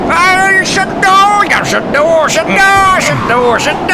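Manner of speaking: frantic high-pitched hollering; hammed-up panicky shouting; intense. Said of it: "Jesus, these people are intense".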